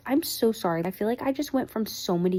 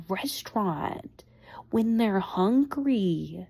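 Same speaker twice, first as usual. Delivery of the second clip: slow hushed emphasis; much slower than usual. Real, not acted. Punchline about hungry customers.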